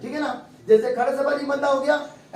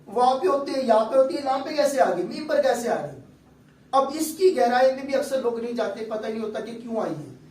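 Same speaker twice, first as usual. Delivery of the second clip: normal talking voice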